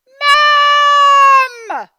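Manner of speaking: put-on very high, loud child's yell; shouting for mum. Stan calls for mum.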